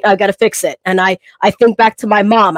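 ordinary talk, nothing marked

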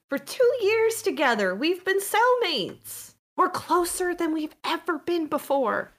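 high-pitched, insistent cellmate voice. Acted.

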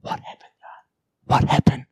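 whispered in awe. Retold or imitated. Friend asks the preacher after the fight, whispered. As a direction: breathe it out, stunned. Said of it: "Anders looked at me and said".

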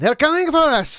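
high shouted Spanish cry; mock alarm. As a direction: cry out like a panicked bandit. Mock alarm, police coming.

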